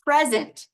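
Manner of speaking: "Present" with PRES hit high and loud, falling away; stress on first syllable. Shown as a demonstration. Teacher shows a class how stress changes meaning, stress on first syllable of "Present". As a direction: punch the front of "Present", PREZ-ent. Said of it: "depending on how I say it".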